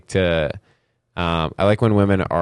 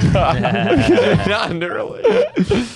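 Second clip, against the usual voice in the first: laughing; 11 semitones higher, slower than usual. Real, not acted. Laughing echo of punchline.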